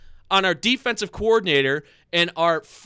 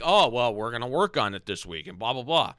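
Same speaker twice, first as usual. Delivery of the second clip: lower, softer, dismissive mock voice; mocking the coaches; 10 semitones lower, 5 dB quieter than usual. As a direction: brush off the excuse with disdain. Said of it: "they're like".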